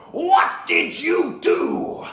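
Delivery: high, loud, very drawn-out words; furious shout. Acted. Villain demands his master answer for it, enraged shout. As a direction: roar it slowly, word by word. Same question repeated as a shout.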